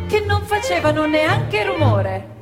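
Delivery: ordinary talk, nothing marked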